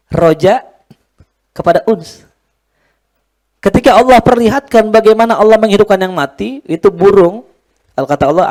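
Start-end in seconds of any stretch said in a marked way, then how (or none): 3.6-7.5 fervent preaching. Acted. Preaching at full force.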